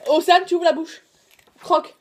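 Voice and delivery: loud, firm orders; commanding. Real, not acted. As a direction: order her to bite down. Ordering her to bite.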